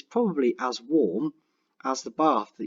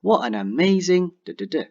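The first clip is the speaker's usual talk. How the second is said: exclamative; 6 semitones higher, 5 dB louder than usual. Shown as a demonstration. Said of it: "you'll say".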